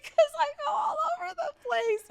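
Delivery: very high, loud mock sob; crying wail. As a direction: reenact a tearful outburst. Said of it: "And so I'm crying".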